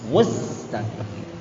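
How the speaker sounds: "mustaqim" stretched slowly, lips rounded first, then the mouth opens; drawn-out demo. Shown as a demonstration. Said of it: "Dhammah dulu baru buka mulutnya". ("mustaqim" pelan, bibir monyong dulu lalu buka)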